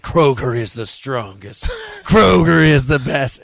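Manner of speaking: slow, drawn-out boast; alien boast. Retold or imitated. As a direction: chant it slowly like a movie alien. Quotes movie alien's battle boast.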